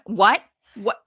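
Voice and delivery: loud, incredulous double take; imitated disbelief. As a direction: act out stunned disbelief. Retelling Punk's incredulous reaction.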